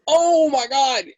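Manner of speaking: loud, high oh-my-God; shocked realisation. Retold or imitated. Teller to her sister as it dawns, shocked outburst. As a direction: burst out as it sinks in.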